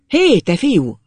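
loud, high-pitched hail; shouted call. A narrator's reading. Old man calling to the rider lad, loud shout. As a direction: shout after the boy to stop him. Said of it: "ordított rá Bucsi bátyja".

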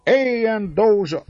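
high, slow cheering cry; excited cheers. A narrator's reading. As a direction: cheer him as king. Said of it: "E kiáltások".

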